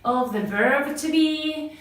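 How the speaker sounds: slow, careful classroom English; measured teaching voice for Spanish learners; slow teacher English. Acted. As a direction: spell it out slowly for beginners.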